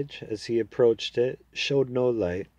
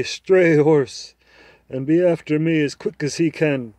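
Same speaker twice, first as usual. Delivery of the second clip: proud talking horse, higher and louder, persuading; a confident horse making its case to the boy; 5 semitones higher, 8 dB louder than usual. Acted.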